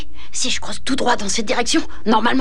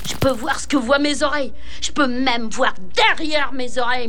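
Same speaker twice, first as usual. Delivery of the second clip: ordinary delivery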